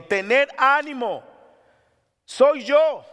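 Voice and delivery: slow, loud Jesus reassuring disciples; Jesus calling out. Acted. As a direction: call out over the storm. Quoting Jesus calming disciples.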